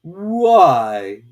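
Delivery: loud, short one-word challenge; curt caterpillar. A narrator's reading. Caterpillar curtly answering Alice, loud. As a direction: toss it back at her.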